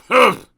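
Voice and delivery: loud cross grunt. Acted. Mister Grumpy grunting at Mister Happy, loud and cross. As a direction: huff it out. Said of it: "grunted Mister Grumpy".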